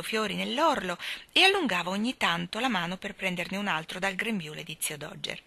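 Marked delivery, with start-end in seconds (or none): none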